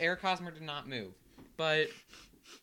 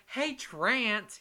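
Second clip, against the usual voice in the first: loud Southern fan holler, pitched high; southern accent; 7 semitones higher, 6 dB louder than usual. Retold or imitated. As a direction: call the name out in a Southern accent. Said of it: "with a southern accent".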